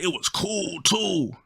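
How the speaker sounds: high, smug homie impression; friend's voice. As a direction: add it smugly in his high voice. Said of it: "Yeah, that's how he talked".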